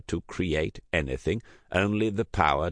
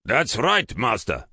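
put-on urgent, louder Basque accent; 6 semitones higher, 5 dB louder than usual. A narrator's reading. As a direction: plead in a thick Basque accent. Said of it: "thickened his Basque accent".

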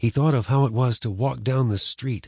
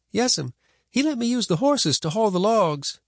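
put-on higher, polite boy; boy's voice; 12 semitones higher than usual. A narrator's reading. Boy answering.

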